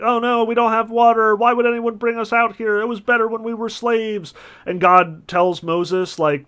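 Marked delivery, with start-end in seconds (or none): 0.0-4.3 mock complaining crowd, higher and louder; whiny Israelites. Israelites complain to Moses in the desert, mock whine. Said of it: "the Israelites are crying out, like".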